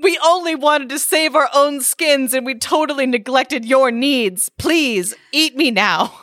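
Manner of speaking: hammed-up guilty goat; mock earnest goat. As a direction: goat insisting the troll eat him. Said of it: "The other goat replies".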